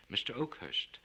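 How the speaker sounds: plain reading voice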